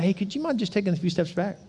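soft, restrained asking; polite request. Acted. Adult to someone too close, soft polite request. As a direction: quietly ask for some room.

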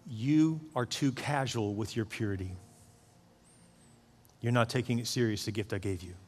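solemn voice of God. Retold or imitated. God speaking in a dream.

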